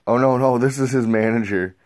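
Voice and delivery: a hammed-up mom saying no; mock mom protest. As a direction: push him off as the flustered mom. Mom quoted protesting flirting.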